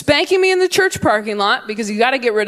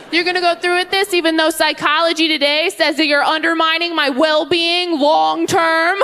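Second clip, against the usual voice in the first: put-on whiny bratty kid; 6 semitones higher than usual. Kid mocking parent.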